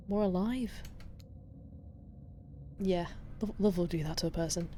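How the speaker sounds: soft, hushed ASMR voice for the ocean. Acted. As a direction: murmur close to the mic like the sea. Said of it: "an A S M R voice".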